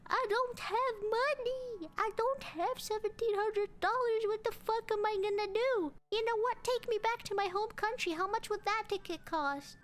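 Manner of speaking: high, whiny mock wail; panicked crying. Passenger telling agent she has no money, panicked crying. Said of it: "I was panicking".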